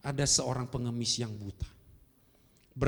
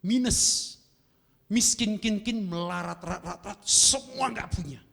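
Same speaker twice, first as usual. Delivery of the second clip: dramatic preaching cry; 7 semitones higher, 4 dB louder, slower than usual. Acted. Preacher dramatizing having nothing.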